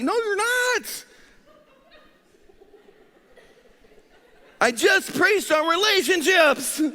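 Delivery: high, loud, slow exasperated yell; hangry protest. Retold or imitated. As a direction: drag it out in hungry outrage. Retold hangry protest to wife.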